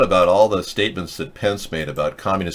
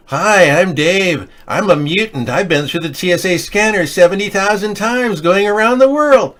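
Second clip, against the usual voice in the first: mutant Dave voice; 9 semitones higher, 4 dB louder than usual. Pretend. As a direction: play a cheerful mutant introducing himself, rising to a squeal at the end. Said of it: "This is gonna be your new look".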